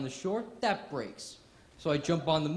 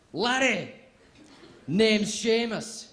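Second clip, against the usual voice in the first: loud, high-pitched Scottish brogue; Seamus, Scottish accent; 6 semitones higher, 8 dB louder, slower than usual. Acted. Scottish interviewer greeting a student, big Scottish accent. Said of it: "The guy interviewing me is Scottish".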